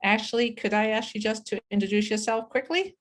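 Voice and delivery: usual speaking voice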